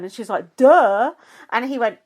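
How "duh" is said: loud, scornful retort; mock duh. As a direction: throw it back as painfully obvious.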